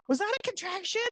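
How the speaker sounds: high, soft startled husband; lightly voiced worried husband; husband asking. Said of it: "he goes".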